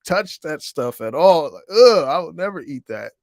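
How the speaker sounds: put-on high, loud childish disgust; a kid grossed out, high and loud ugh. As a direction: recoil like a kid facing pig snouts. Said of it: "I was just like".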